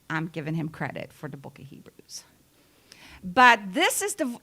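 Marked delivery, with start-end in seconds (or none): 0.1-2.2 quick whispered aside. Real, not acted. Sly aside to congregation.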